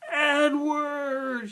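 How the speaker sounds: long, high, loud cry; shouted name. A narrator's reading. Girl calling her lost rabbit from the ship, shouted. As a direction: shout it drawn out across a great distance. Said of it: "she shouted".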